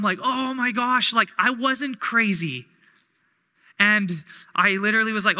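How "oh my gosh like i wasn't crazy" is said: relieved exclamation. Retold or imitated. Relief at being proven right.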